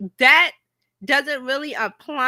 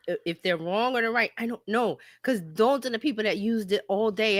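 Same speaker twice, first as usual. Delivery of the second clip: ordinary delivery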